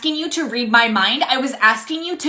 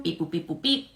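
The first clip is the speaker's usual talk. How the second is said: soft mock scanner beeps; 5 semitones lower, 9 dB quieter than usual. Cashier voicing scanner.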